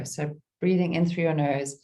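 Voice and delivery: normal talking voice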